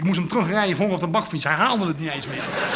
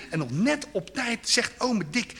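normal talking voice

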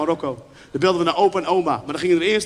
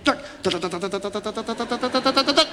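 rapid-fire "tak" creeping upward; imitated rotary dial. Child dialling the long zero, imitated clicking.

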